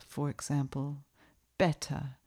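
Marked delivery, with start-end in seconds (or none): 1.6-2.2 crisp British "Better" with a clear t. Shown as a demonstration. Showing the standard English sound, British accent. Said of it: "take the word better".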